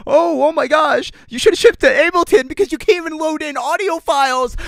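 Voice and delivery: nasal-free mock whine, high and loud; mocking commenter. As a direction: parody a smug commenter. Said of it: "all the comments are gonna be like".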